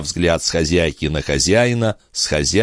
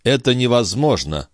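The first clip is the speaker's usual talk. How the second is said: understated exclamation, pitched up; exclaimed; 6 semitones higher than usual. A narrator's reading. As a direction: object in a higher voice, without shouting. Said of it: "воскликнул доктор". (сдержанное восклицание повышенным голосом)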